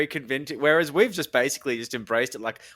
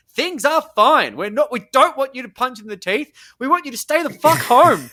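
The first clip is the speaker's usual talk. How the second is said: emphatic rant; 8 semitones higher, 7 dB louder than usual. Real, not acted. Ranting at foreign protesters.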